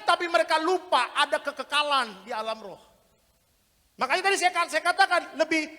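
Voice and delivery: loud, high, shouted preaching; full-force revival preaching; fiery preaching. Acted. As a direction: thunder repentance at the congregation.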